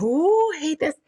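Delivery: spooked shudder. Retold or imitated. Still spooked in the kitchen.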